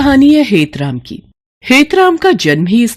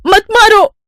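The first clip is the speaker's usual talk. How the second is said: high-pitched yelp of a plea; panicked begging; 13 semitones higher, 4 dB louder than usual. Acted. Stepmother under the stick, panicked high plea. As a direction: beg sharply, very high. Stepmother begs while being beaten.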